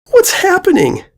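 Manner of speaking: high, tearful panic; terrified. Acted. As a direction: cry out in terror. Filly in tears.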